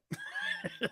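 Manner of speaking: sudden high-pitched giggle; short shrill giggle; high squeaky laugh. Real, not acted. Laughs at the woodpecker line.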